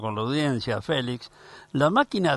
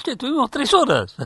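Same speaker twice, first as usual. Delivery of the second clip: excited retelling; 13 semitones higher, 3 dB louder than usual. Retold or imitated.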